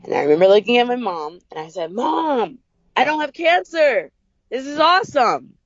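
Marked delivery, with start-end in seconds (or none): 1.9-5.5 much higher, bright burst of delighted disbelief; excited relief. Retold or imitated. Telling her mom after the diagnosis, excited and relieved. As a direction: blurt it out to mom like great news.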